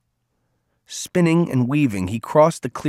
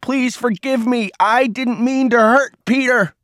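pleading yell, pitched way up; screamed plea; 12 semitones higher, 3 dB louder than usual. A narrator's reading. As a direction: cry out desperately into the dark. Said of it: "he screamed louder".

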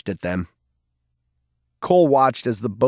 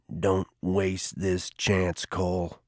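slow and much softer, low and heavy; sad warning; 6 semitones lower, 8 dB quieter, much slower than usual. A narrator's reading. Garvey pleading with Cole as he leaves, slow and soft. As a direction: let it sink in, sorrowfully.

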